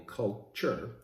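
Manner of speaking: "culture" separated into cult and ture; split word. Shown as a demonstration. Host explaining the name, "culture" split as cult... ture. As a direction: break "culture" after cult to expose the word inside. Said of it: "if it's truly a cult".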